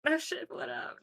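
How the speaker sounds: high, bright "what up" greeting; excited greeting. Pretend. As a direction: blurt it out, way up high. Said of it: "No, I don't say that".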